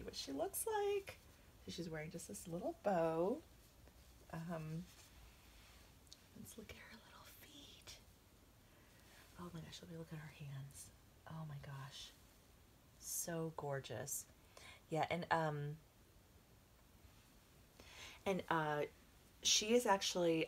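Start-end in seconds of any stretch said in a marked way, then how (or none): 6.4-14.2 very soft near-whisper; hushed awe. Real, not acted. Examining the doll's tiny features.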